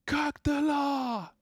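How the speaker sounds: high, drawn-out mock cry; imitated player's yell. Caster checking in on the player's cry, mock yell. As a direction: stretch it out like a celebrating player. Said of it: "I'm pretty sure he's saying Cactala".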